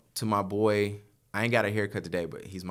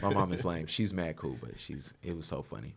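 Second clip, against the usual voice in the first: normal talking voice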